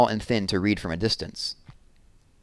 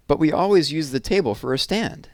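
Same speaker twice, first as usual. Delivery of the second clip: put-on higher, eager little-girl voice; young girl Jessie; 8 semitones higher than usual. Little sister Jessie eagerly offers her lockbox to her brother, higher girl voice.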